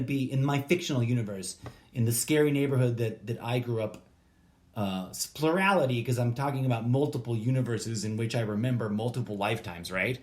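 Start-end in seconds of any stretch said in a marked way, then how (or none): none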